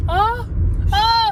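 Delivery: sharp high cries of panic; panicked cry. Real, not acted. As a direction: cry out in real terror.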